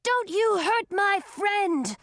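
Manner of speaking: high, fierce little dragon; shouting. A narrator's reading. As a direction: shout it to defend a friend.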